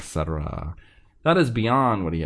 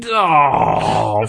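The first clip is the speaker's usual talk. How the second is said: loud, drawn-out mock "oh"; acted exclamation; 8 dB louder than usual. Reading a listener's review aloud, big acted exclamation. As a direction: belt out a long exclamation. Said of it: "make me say".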